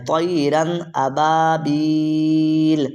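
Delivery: "طَيْرًا أَبَابِيلَ" opening on a thick, rounded T, last vowel held long; heavy T recitation. Shown as a demonstration. Teacher models the verse for students, heavy T in "طَيْرًا أَبَابِيلَ". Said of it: "طا پڑھنا ہے".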